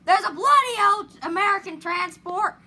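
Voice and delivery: put-on British accent. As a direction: belt it out like a Brit. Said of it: "why did I switch my accent to British".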